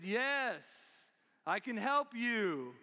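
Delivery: loud, slow, drawn-out answer; booming-loud acted reply from above. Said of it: "heard a voice from above".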